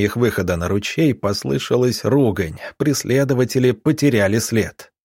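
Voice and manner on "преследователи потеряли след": usual speaking voice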